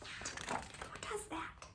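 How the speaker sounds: slow, hushed disbelieving whisper; slow conspiratorial whisper. Acted. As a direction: whisper it as if scandalised.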